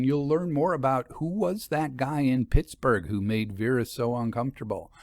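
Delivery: usual speaking voice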